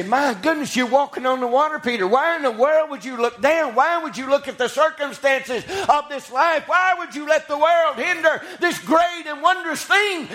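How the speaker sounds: high-pitched, rolling preacher's questioning; full-force preaching. Acted. Preacher challenges Peter for looking down, preaching hard.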